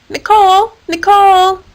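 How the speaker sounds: high, loud call. A narrator's reading. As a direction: call up to her on the wire. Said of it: "Hilary called out to her".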